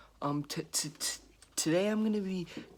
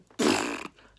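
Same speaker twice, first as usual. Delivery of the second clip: short laugh; 10 dB louder than usual. Real, not acted. His day turns sour.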